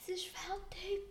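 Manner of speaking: soft unvoiced whisper. Real, not acted. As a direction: whisper it off-mic. Whispering while recording paused.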